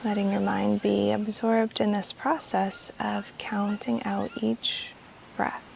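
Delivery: guided meditation. Real, not acted. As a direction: guide them gently through each breath, unhurried. Leads a guided breathing meditation.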